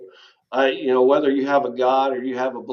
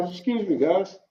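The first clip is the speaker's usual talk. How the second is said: real tears held back, voice catching; fighting back tears while apologizing; getting emotional; 5 semitones higher than usual. Real, not acted. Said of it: "I swore I wouldn't do this".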